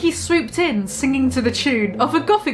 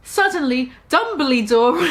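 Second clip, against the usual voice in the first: ordinary delivery, nothing marked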